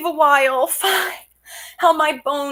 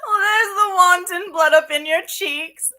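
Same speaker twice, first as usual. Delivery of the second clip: loud, laughing teasing; 5 semitones higher, 3 dB louder than usual. Acted. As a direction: cackle at her blushing. Nurse teases blushing Juliet.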